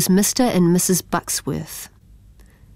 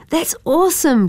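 excited cry; 10 semitones higher than usual. A narrator's reading. Boy cheering his brother's news, excited. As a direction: burst out with joy. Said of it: "cried Yosefa".

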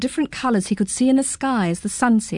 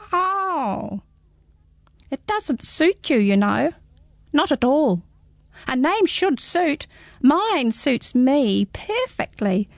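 high, small squeaky creature; a tiny curious hedgehog peering up; high little hedgehog, puzzled; 5 semitones higher, slower than usual. Acted. Hedgehog speaking.

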